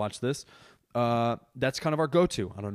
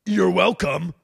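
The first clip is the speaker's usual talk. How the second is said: mimicking a smug man; 6 semitones higher, 8 dB louder, slower than usual. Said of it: "he goes".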